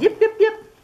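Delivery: very high, far yipping fox; fox calling. Acted. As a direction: yip out like a little fox calling. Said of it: "She called".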